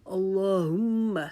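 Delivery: اللہم slow, with a catch on the doubled م and no drawn-out vowel; recitation. Shown as a demonstration. Teacher reciting اللہم slowly for students. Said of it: "رکاوٹ تو کریں گے لیکن ہم اس کو لمبا نہیں".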